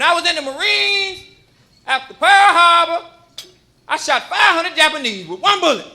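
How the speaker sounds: put-on high, loud boastful old man; bragging grandpa at dinner; old war veteran. Grandfather telling war stories at Thanksgiving.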